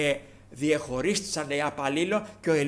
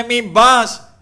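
pleading cry; 6 semitones higher, 14 dB louder than usual. Retold or imitated. Crying brothers beg Paul.

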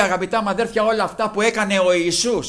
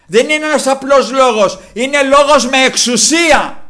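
loud, high declaration, building; full-force preaching; 6 semitones higher, 8 dB louder than usual. Real, not acted. Preacher testifying that the Word has authority, full-force preaching.

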